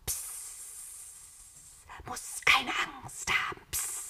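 sibilant serpent-like whisper, sounds drawn out; eerie whispered creature voice; hissing voiceless whisper. A narrator's reading. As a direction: hiss it like a snake luring someone. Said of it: "zischte und wisperte ganz ohne Stimme".

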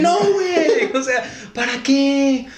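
high, drawn-out placating plea; mock cowardly backpedaling. Driver talking his way out of a fight, mock pleading. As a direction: back down in a squeaky, stretched voice.

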